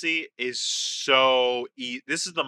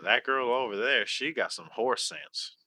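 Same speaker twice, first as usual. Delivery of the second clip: softer, slow Southern; Southern accent; 4 semitones lower, 5 dB quieter than usual. Acted. Player tries the quiz phrase, slow Southern accent. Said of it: "my Southern ain't bad".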